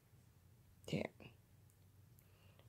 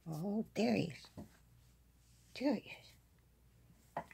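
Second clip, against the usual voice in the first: excited; 5 semitones higher, 5 dB louder, slower than usual. Real, not acted. Spotting the hiding cat.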